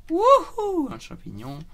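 very high loud whoop; excited whoop. Real, not acted. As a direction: cheer with joy. Finds a mushroom.

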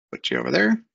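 ordinary talk, nothing marked

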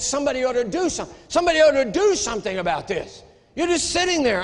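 retold indignant shouting, pitch way up; outraged. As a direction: replay your own self-righteous fury at the pastor. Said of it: "I was outraged".

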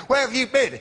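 high, sharp teacher demand; mock furious. A teacher demanding where the pupil was, high and sharp. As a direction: snap it high at the late kid. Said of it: "I was furious".

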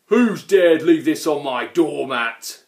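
loud, angry troll growl. A narrator's reading. Grunt finds an invitation on his doormat, growling. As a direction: growl it loudly like a grumpy troll. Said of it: "growled the Grunt".

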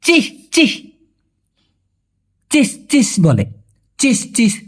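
high-pitched চিস, চিস of disgust; high scornful tut; disapproving চিস. Shown as a demonstration. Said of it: "অথবা কি বলা হয়".

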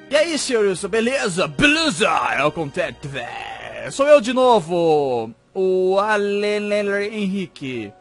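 loud, drawn-out mock fan greeting; overplayed shouted hello; hammed-up letter-writer greeting. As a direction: read the greeting with exaggerated, stretched-out show. Reads viewer letter in hammy voice.

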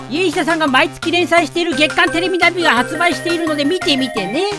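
ordinary talk, nothing marked

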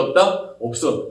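normal talking voice

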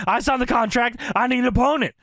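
high-pitched mock yell; retold screaming demand. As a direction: imitate a wrestler yelling his demand. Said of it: "screaming at Eric Bischoff and everybody".

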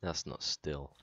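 ordinary talk, nothing marked

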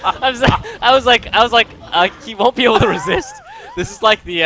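high, loud talk through real laughter; laughing. Real, not acted. Teasing friend about an irresistible joke setup, laughing hard. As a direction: crack up while getting the words out. Cracking up at teammate's accidental setup.

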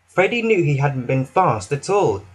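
ordinary delivery, nothing marked

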